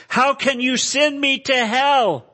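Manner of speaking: high, loud, slow anguished cry; desperate protest. Acted. Acted sinner protests to Jesus.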